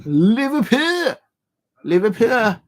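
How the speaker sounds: calling out loud and high; yelling across the room. Real, not acted. Calling out the half-time score.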